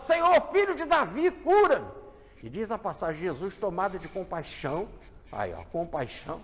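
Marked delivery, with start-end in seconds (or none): 0.0-1.9 clamor agudo e muito forte; grita mais alto. Acted. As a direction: solta o grito de súplica. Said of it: "começa a gritar mais alto". (cego gritando mais alto para Jesus)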